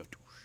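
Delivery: soft breathy "toosh", barely voiced, like a fish sucking in bait; imitated fish strike whoosh. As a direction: mimic the quick slurp of a bass grabbing the lure. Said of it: "they would just go".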